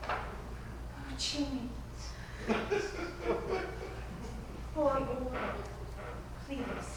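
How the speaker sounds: frail, lingering deathbed murmur; hushed dying heroine. Acted. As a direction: let the words fade away tenderly. Plays heroine's dying scene.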